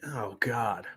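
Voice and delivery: breathless whisper of dread. Real, not acted. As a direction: whisper it, barely there. Reacting to horrible smell.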